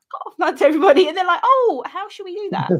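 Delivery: high, loud, laughing excitement; carried away by the story; getting carried away retelling the scene, high and loud; excited. Real, not acted. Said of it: "I got a bit excited".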